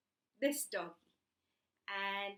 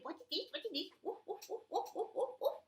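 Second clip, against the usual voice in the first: curious puppy; 8 semitones higher, faster than usual. Acted. Spotty finds something, barks softly.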